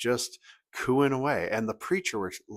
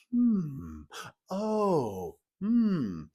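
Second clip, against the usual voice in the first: high, drawn-out murmurs of approval; imitated reflective hums; 9 semitones higher, much slower than usual. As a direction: hum like an impressed congregation. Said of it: "reflective comment where everyone went".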